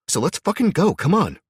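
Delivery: high, loud, fierce urging; urgent loud push. Acted. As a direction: shove him into action with your voice. Abby urging Jack to move.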